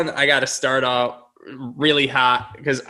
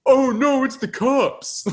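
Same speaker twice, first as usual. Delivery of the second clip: mock alarm; 11 semitones higher, slower than usual. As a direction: ham up the cops alarm. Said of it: "The delivery of".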